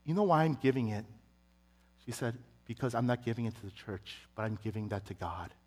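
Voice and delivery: a mother quietly setting her son straight; gentle mother. Retold or imitated. Mom explaining her seven hundred dollar gift, softly.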